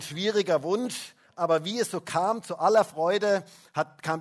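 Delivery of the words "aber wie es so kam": usual speaking voice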